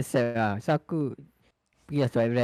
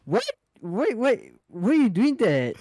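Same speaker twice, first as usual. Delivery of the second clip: indignant; 8 semitones higher, slower than usual. Retold or imitated. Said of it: "I feel like".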